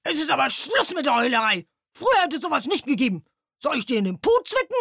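put-on loud, fast grumpy old-timer scolding; an old stag beetle scolding the crying owl, sternly. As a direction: rattle off a stern telling-off. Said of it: "sagte streng".

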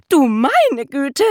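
high, loud, slow cry of alarm; maid shrieking. A narrator's reading. The maid sees flying pigs and cries out high and loud. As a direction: shriek like a startled maid at the window. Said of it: "schrie sie".

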